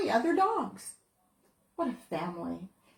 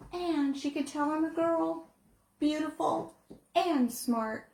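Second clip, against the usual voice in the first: plain reading voice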